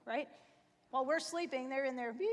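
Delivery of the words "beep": ordinary talk, nothing marked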